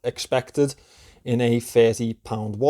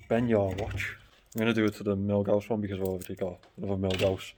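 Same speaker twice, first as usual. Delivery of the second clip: muffled behind a face mask; talking muffled through a mask; 4 semitones lower, 7 dB quieter than usual. Real, not acted. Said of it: "so excuse the mask".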